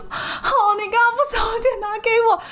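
mock sob a complaint through tears; reliving a teary scolding of the cleaner. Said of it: "眼泪都还没干嘛".

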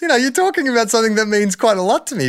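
high put-on voice of offence, mid-laugh; mock offended retort. To Georgie Parker, a hammed-up high offended retort. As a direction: play it up as wounded pride. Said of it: "I was like".

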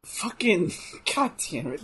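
ordinary talk, nothing marked

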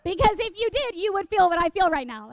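high-pitched zealous insistence; freaking out. Retold or imitated. Zealous teen argues with her friend, high, urgent insistence. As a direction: press the point, overwrought and quick. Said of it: "and I'm freaking out".

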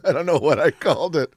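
high laugh through the words; real laugh while admitting the blunder; laughing at himself. Real, not acted. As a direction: laugh off the mix-up. Laughing at his own mix-up.

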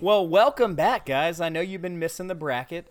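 upbeat host welcome; host intro. Acted. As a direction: open the show with energy. Host opens bracket segment.

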